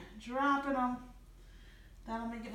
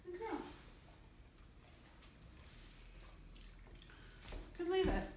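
high praise voice; 7 semitones higher, 12 dB quieter than usual. Real, not acted. Trainer to dog: high sing-song praise. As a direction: praise her in a bright high voice.

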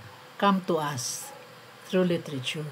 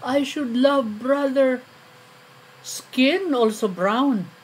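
higher, louder, drawn-out recited plea; quoted appeal; 7 semitones higher, 5 dB louder than usual. Acted. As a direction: stretch it out as a lofty quoted appeal. Poem quotes the accused's appeal.

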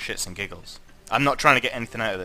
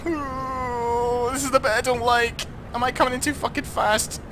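high strained fretting; tense fear; 12 semitones higher than usual. Real, not acted. Streamer fretting over a hard landing, high and strained. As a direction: whimper it through the tension.

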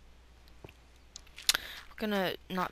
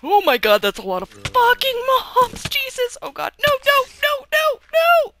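screaming in terror, much higher; terrified horror victim; 17 dB louder than usual. Real, not acted. Swarmed by mobs, screaming.

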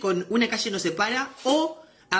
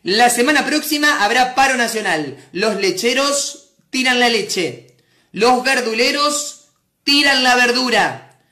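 loud, slow news bulletin; hammed-up news presenter, spaced phrases; newsreader voice; 6 dB louder, slower than usual. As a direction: declaim it like a TV newscaster. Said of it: "ponen otra voz".

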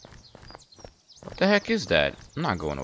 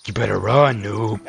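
slightly raised mock threat; in-game voice; 4 dB louder than usual. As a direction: play the menacing gamer, lightly. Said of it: "that's my in-game voice".

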